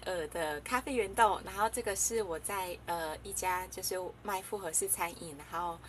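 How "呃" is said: ordinary talk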